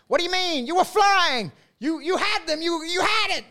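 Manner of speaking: high-pitched, hyped-up Jersey guy impression; over-the-top Jersey boy. Booker praising a comic after his set, over-the-top Jersey boy. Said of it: "like, over the top, um, Jersey boy".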